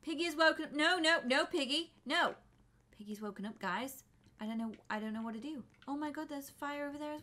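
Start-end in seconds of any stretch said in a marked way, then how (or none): none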